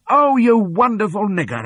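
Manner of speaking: very high, loud gleeful shout; joyful shout. A narrator's reading. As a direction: cheer her in triumph. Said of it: "I shouted".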